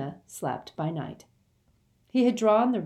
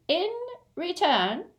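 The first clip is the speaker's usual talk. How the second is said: firm, higher-pitched goblin voice; high and insistent; 13 semitones higher than usual. Acted. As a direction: state terms as an unbending goblin. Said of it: "said the goblin firmly".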